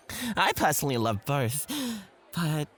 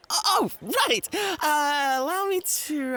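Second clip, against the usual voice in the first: much higher, caught off guard; startled; 12 semitones higher than usual. Acted. Host caught off guard by the guest's prompt. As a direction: jolt back to attention.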